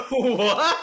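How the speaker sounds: laughing in disbelief; cracking up mid-question. Real, not acted. Reacting to the soundboard.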